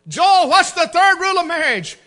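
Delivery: high, loud drill-sergeant question; loud quizzing call. Retold or imitated. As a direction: fire the question at him on speakerphone. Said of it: "I'd say".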